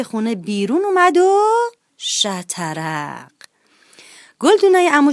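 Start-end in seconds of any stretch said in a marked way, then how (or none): 2.0-3.3 long drawn-out crash noise; crash sound. A narrator's reading. Narrator voicing the vases smashing, drawn-out crash. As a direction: stretch the crash out slowly.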